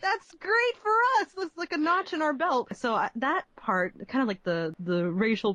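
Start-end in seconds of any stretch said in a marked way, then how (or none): 0.0-2.7 high-pitched, animated impression of a proud father; imitating delighted dad. Imitating dad reacting to his daughter's game, delighted. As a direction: play Dad, thrilled for the family. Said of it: "he's like".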